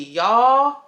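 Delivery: ordinary talk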